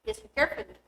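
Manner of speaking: ordinary delivery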